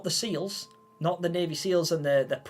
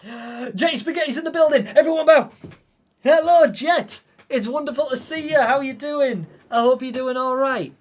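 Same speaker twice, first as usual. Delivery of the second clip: much higher, much louder delighted welcome; excited greeting; 9 semitones higher, 11 dB louder than usual. Real, not acted. Streamer greeting a favourite viewer arriving on his birthday, loudly overjoyed. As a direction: throw the door open with joy.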